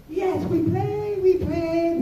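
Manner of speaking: put-on high, slow sing-song chant of little kids. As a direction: chant it like toddlers.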